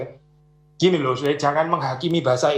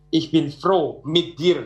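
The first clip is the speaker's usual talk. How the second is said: slow German demo; much slower than usual. Shown as a demonstration. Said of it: "Kalau bahasa Jerman juga ngomongnya gitu".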